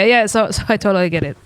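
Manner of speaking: normal talking voice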